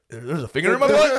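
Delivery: high, loud, incredulous question; hammed-up astonishment; imagined realisation. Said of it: "you're like".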